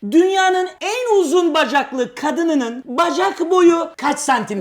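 slow, loud quiz-host delivery; quiz question. Real, not acted. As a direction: announce the question grandly, word by word.

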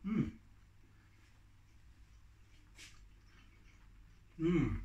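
speaking between bites; chewing while talking. Real, not acted. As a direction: mouth partly full. Seen 1: Bites food at -12, then closed-mouth jaw movement until speaking.

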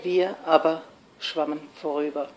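low, soft, subdued close; understated sorrowful verse; desolate poem ending. Acted. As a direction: sink into quiet hopelessness. Poem turns desolate at its end.

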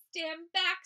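shouted stand back, elephant warning; loud warning. A narrator's reading. Elephant warning the animals again, shouting stand back. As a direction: call out to clear the way. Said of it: "said the elephant".

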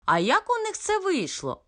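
astonished mother, pitch leaping high; high, amazed question; surprised. A narrator's reading. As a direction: ask in high-pitched wonder. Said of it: "здивовано запитала мама".

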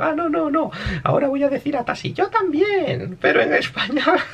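high put-on girly voice; mock self-correction in piping falsetto; cambio a femenino. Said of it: "Él también estaba hablando como un hombre". (corrigiéndose con voz aguda de mujer)

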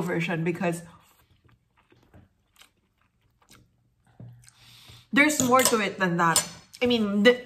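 talking with food in mouth; speaking between bites; chewing while talking. Real, not acted. Seen 1: Bites from fork at -4s; jaw and cheeks look chewing early on.

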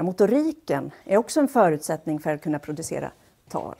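ordinary talk

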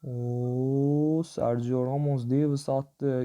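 ordinary talk

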